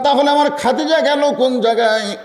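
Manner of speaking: high-pitched acted alarm; startled. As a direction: cry out as if suddenly alarmed. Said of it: "চমকে গেলাম". (চমকে ওঠার অভিনয়ে উঁচু গলা)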